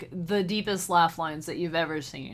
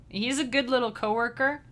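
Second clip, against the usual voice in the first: ordinary talk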